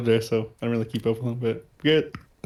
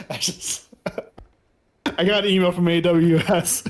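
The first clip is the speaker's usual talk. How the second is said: normal talking voice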